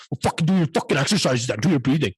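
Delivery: imitating dad snapping through his anger; mock angry dad. Dad snapping at her about breathing exercises, mock angry. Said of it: "He's like".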